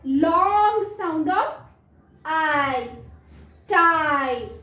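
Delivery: "Long sound of I" drawn out very slowly, then "स्टाइल" stretched; slow phonics demo. Shown as a demonstration. Mother teacher showing kids the long I in "स्टाइल", slow. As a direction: spell out the long I sound for children. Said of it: "कौन सी sound दे रहा है".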